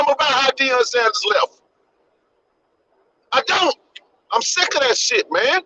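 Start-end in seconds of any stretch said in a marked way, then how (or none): none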